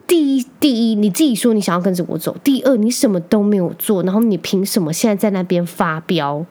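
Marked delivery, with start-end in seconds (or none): none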